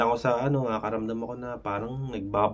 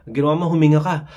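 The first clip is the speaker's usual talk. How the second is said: loud forced breathing cue; 4 semitones higher, 8 dB louder, much faster than usual. Shown as a demonstration. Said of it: "naisip mo yung sinabi ko".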